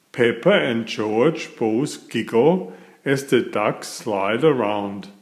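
usual speaking voice

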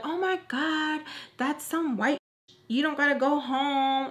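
mocking friend. Imitating her friend who pushes her to stay, mock voice. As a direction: play the pushy friend, lightly.

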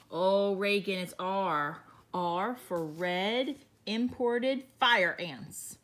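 ordinary talk

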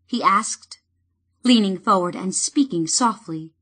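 ordinary delivery, nothing marked

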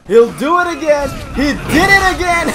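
loud, high exclamation; excited crowing. Real, not acted. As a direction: crow loudly over the repeat move. Cheers own repeated move.

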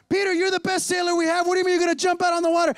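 loud, high, hammed-up disbelief; alarmed protest. Disciples stopping Peter from jumping out of the boat, loud alarmed protest. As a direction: yell at him in mock alarm.

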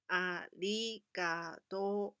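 slow, careful ありがとう with a long final とう; teacher modelling the word; slow model arigatou. Shown as a demonstration. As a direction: spell ありがとう out slowly for children. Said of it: "把这个偷的发音延长就好".

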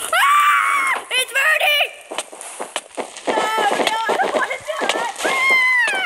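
high frantic yells of pain; panicked screaming. Pretend. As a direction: scream like you're on fire. Character burning in lava.

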